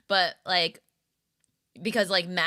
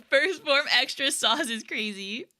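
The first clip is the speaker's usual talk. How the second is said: laughing; 7 semitones higher, 3 dB louder than usual. Real, not acted. Laughing at own joke.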